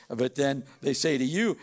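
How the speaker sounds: ordinary talk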